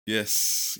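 normal talking voice

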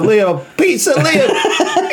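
high, laughing mock introduction. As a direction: squeak the name through laughter. Acting out Liam, laughing.

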